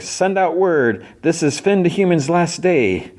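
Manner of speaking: high-pitched royal proclamation; proclaiming princess. Retold or imitated. Princess sends word to her subjects, high proclamation. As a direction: announce it high and drawn out.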